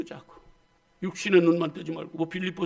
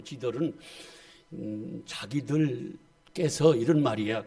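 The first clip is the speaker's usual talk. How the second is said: Chungcheong dialect; 7 semitones lower, 3 dB quieter, slower than usual. Shown as a demonstration. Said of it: "충청도 말로".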